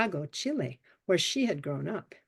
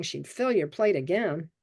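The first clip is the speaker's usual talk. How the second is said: ordinary delivery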